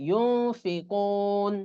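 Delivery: ইউনফিকুন very slow, ন hidden nasal before ফি; slow ikhfa demo. Shown as a demonstration. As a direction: draw out ইউন with a nasal hum. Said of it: "এরকম উচ্চারণ হবে". (ধীরে গুন্নাসহ ইউনফিকুন)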